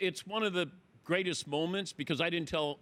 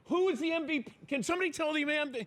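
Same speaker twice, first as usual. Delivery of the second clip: yelling out; 12 semitones higher, faster than usual. Retold or imitated. Host yelling to the crew to learn the MVP, frantic high shout. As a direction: shout it into the chaos to anyone listening. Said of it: "I yelled out".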